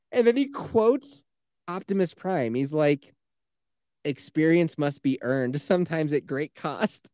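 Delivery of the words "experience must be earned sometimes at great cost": usual speaking voice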